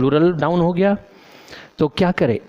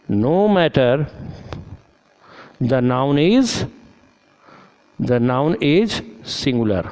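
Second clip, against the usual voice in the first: slow dictation; much slower than usual. Shown as a demonstration. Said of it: "teacher dictates rule slowly".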